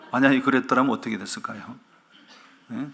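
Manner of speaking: ordinary delivery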